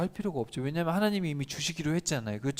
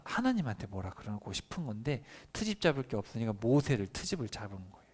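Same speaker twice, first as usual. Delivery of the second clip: normal talking voice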